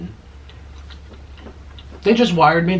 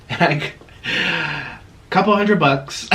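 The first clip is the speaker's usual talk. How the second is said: laughing through the words; giggling at the tiny pay; 5 semitones higher, much slower than usual. Real, not acted.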